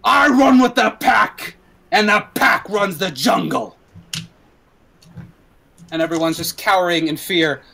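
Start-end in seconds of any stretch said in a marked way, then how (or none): 0.0-3.8 higher and slow, proud declaration; gang leader boast. Acted. Axel boasting to the street while firing, swaggering. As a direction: proclaim it over gunfire. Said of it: "starts firing his gun".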